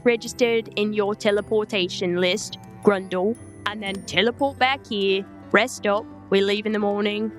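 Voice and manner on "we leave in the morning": ordinary talk, nothing marked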